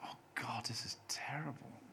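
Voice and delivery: hushed, whispered groan of dismay; dismayed thought. Retold or imitated. Writer watching his first episode being recorded, dismayed whisper. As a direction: whisper it as a private, horrified thought. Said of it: "I thought".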